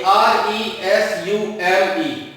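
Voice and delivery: letter by letter, slow; spelled out. Shown as a demonstration. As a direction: spell each letter out slowly. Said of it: "इस शब्द को पढ़िए".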